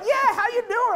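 high, laughing, friendly how you doing; laughing casual hello; breezy greeting. Retold or imitated. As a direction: greet him like an old buddy. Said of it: "he's like".

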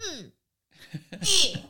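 put-on high, loud grumpy child grunts; mock pouty mm and eh; imitated sulky grunts. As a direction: mimic a sulking kid's huffs. Daughter's grumbling noises retold.

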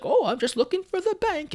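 put-on high, quavery old granny; old lady voice. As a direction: play a frail little grandma. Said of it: "She's a very old lady".

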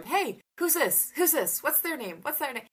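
put-on sing-song quizzing a toddler; high coaxing voice for a toddler; playful baby-talk questions.

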